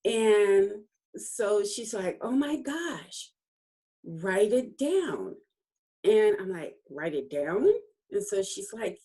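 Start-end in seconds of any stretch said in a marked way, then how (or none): 2.2-5.3 excited urging. Retold or imitated. Coach tells her to write the memory down, excited urging. Acts out coach's delighted instruction. 6.9-7.7 questioning echo with a rising end; puzzled echo. Retold or imitated. She questions the coach's order to write it down, puzzled echo.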